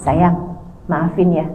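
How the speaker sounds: low, soft husband's plea; apologizing husband. Acted. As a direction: ask your wife quietly for forgiveness. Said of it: "tiba-tiba suami bilang". (suami minta maaf dengan suara rendah dan lembut)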